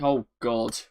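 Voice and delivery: usual speaking voice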